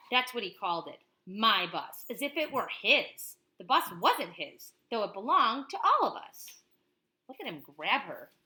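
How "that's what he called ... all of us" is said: lively read-aloud of a picture book; reading to a child. Acted. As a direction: tell it like a bedtime story to a child. Parent reads picture book aloud.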